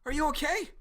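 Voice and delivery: Dylan checking on his friend; worried friend. Retold or imitated. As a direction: call out anxiously to a hurt friend. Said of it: "Dylan rushes over".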